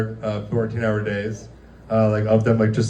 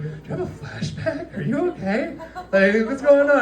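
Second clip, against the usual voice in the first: very high, thin questioning; high-pitched imitated worry; mock gentle concern; 14 semitones higher than usual. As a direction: check on them in a high careful voice. Acted worried check on coworker.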